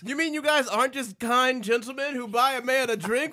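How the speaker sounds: mock surprise. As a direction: play the naive friend suddenly catching on. Naive straight friend realizing, acted.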